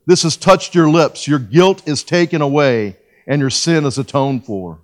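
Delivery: loud, slow, solemn Bible reading; preacher reading Isaiah aloud, loud and drawn out; scripture reading. Acted. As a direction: proclaim the verses slowly to the congregation.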